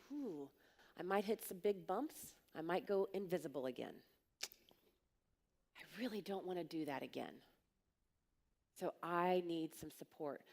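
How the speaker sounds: understated retold nerves; a little nervous. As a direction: voice the worry quietly, with pauses. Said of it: "I got a little nervous".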